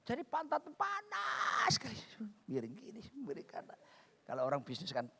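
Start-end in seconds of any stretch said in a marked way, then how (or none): 0.1-2.0 high, strained, softer whine; comic suffering. Retold or imitated. Preacher recalls sore bottom in economy, comic suffering. Acting out economy seat misery. 2.5-3.8 hushed discomfort. Retold or imitated. Passenger shifting about in economy seat, hushed discomfort.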